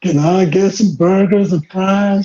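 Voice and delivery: slow, drawn-out Southern twang; hammed-up Southern drawl; Southern American accent. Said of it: "you guys all went Southern".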